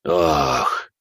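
a hint of an old man. Old man reacts to news.

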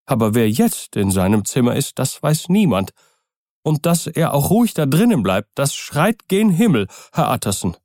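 high, swinging, insistent pitch; agitated servant Poole. Acted. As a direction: plead your case with rising agitation. (aufgebrachter, eindringlicher Diener)

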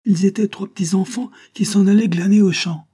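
high, loud, rushed breathy recall; sudden breathless recall. A narrator's reading. Geneviève cutting Fernand off, breathless excitement. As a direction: blurt it out in excitement. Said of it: "Brusquement, Geneviève l'interrompit".

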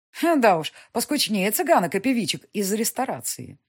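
язвительная насмешка, громче и выше; с издёвкой бросить; едко. Acted. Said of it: "едко заметила графиня". (едко, с насмешкой)

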